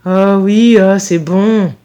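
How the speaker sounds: fed-up, drawn-out reply; exasperated teenager. A narrator's reading. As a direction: brush mum off, exasperated. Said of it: "leva de nouveau les yeux au ciel".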